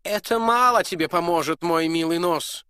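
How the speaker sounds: high, loud mocking prince voice; laughing prince. Prince to Jacob, a mocking laugh in the voice. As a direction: tease the poor dwarf. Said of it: "ответил князь со смехом".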